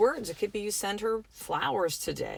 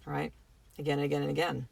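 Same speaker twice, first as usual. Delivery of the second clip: usual speaking voice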